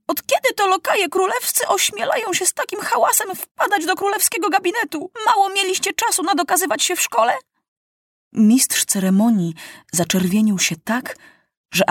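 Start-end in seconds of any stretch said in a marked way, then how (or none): 0.1-7.3 much higher, fast angry rebuke; angry scolding. A narrator's reading. Furious king scolds the rowdy footmen, high and fast. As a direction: tear into them, speeding up. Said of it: "Zaczerwienił się ze złości".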